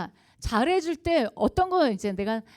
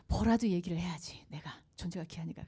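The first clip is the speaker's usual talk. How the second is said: breathy inner whisper, barely audible; whispered thought; 4 semitones lower, 7 dB quieter than usual. Retold or imitated. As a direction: whisper the father's private resolve to himself.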